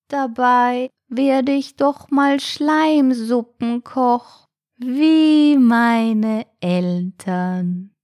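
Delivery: high, very drawn-out snail voice; slow, plaintive snail. A narrator's reading. Snail explains it will cook slime soup, plaintive. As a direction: stretch the words out plaintively.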